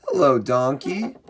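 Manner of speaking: ordinary talk, nothing marked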